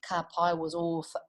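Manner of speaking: plain reading voice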